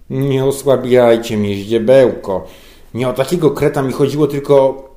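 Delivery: loud exasperated scolding; exasperated boss. A narrator's reading. Boss losing patience with his underling, loud. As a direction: scold the dimwit in despair.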